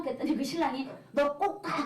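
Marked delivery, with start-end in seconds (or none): 1.1-1.8 imitated husband's order, higher pitched; mock-bossy urging; insistent. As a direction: order her to go, retold. Husband echoes the order to wife.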